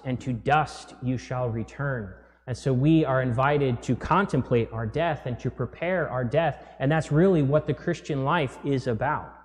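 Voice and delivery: ordinary talk, nothing marked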